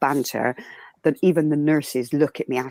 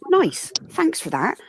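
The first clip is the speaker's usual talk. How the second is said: sarcastic; 12 semitones higher, slower than usual. Retold or imitated. Retold sarcastic reply.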